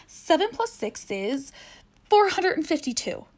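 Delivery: high, proud, confident; proud wrong answer. Acted. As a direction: say it boldly, sure of yourself.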